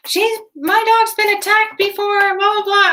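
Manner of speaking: high, panicky imitation of a dog owner; frantic, high-pitched mock rant; mock hysterical. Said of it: "this lady was completely hysterical".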